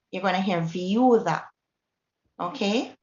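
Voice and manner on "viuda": "viuda" as VYOO-da, the U heard over the I; stress on the U. Shown as a demonstration. Teacher showing U coming second, "viuda" stressed on the U. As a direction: lean on the U of "viuda", gliding past the I. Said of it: "If you come second".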